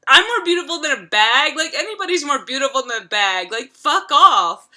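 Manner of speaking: put-on imagined rant at the boy, loud and indignant; pissed off. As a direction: tell him off like you're insulted. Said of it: "I'd be so pissed off".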